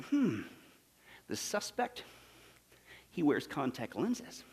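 hushed, slow deliberation with pauses; pondering intently. Acted. Third trainee studying the suspect's picture, quietly pondering. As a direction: muse softly, like studying a clue. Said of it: "he looks at the picture intently".

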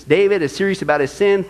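usual speaking voice

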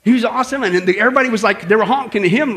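ordinary talk

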